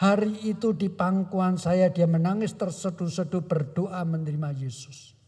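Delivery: ordinary talk, nothing marked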